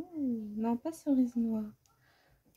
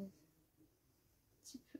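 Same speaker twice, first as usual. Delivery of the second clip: whispered aside; 26 dB quieter, much slower than usual. Real, not acted. Trailing aside about colour.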